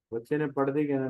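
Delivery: ordinary delivery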